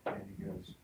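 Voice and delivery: ordinary talk, nothing marked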